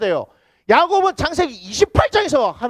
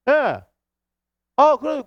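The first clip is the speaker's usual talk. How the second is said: ordinary talk, nothing marked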